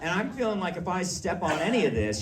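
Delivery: ordinary talk, nothing marked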